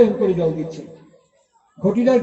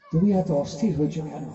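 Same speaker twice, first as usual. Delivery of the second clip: gentle hushed asking, much softer and lower; soft calm question; 7 semitones lower, 7 dB quieter than usual. A narrator's reading. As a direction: ask it softly like a serene Buddha. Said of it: "স্মিত হেসে বলছেন".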